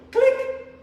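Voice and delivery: high, loud mouth click of a phone; sharp click sound. Retold or imitated. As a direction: snap out a click like a receiver slammed down. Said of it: "Phone straight down".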